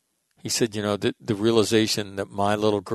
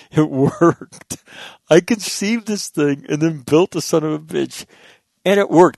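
higher, louder and laughing with glee; bursting with pride that it worked; real excitement; 7 semitones higher, 6 dB louder than usual. Real, not acted. Host telling viewers his studio worked, thrilled laugh. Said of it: "I can't tell you how excited I am".

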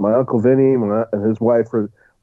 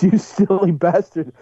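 higher, slow imitation of an annoyed wife; mock scolding wife; 7 semitones higher, slower than usual. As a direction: play the irritated wife on the plane, slowly. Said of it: "she goes".

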